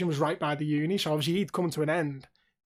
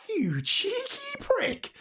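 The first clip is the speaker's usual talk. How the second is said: drawn-out, high, slow scoff; mock indignant retort; much slower than usual. Boy from the street gets a slow, high, sneering retort. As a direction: stretch it out, sneering at the kid.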